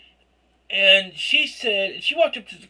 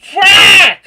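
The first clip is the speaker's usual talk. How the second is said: loud, high frustrated yell; exasperated shout; 11 semitones higher, 16 dB louder than usual. Real, not acted. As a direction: shout it out in embarrassment. Embarrassed outburst.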